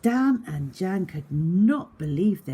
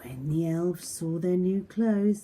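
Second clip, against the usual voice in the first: ordinary delivery, nothing marked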